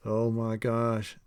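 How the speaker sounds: mild, slow groan of dismay; bored inner thought. A narrator's reading. Boy thinking to himself about the dress shop, bored inner thought. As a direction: draw it out slowly, as a private thought. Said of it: "Damon thought to himself".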